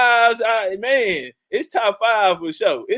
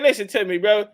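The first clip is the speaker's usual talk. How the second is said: normal talking voice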